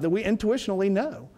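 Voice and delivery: ordinary talk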